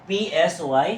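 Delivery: normal talking voice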